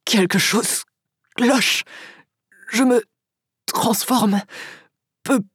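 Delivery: halting words between pauses; pained, struggling. A narrator's reading. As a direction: force the words out through pain. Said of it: "comme s'il souffrait". (voix souffrante, hachée)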